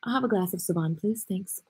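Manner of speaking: a soft, lightly posh restaurant order; mock fancy order. Joking to viewers, ordering a glass of Sovan, mock fancy. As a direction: play at ordering fine wine.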